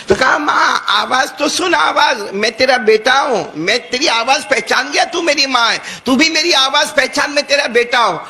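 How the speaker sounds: pleading son. Acted. Son begging his mother at the door, high pleading voice.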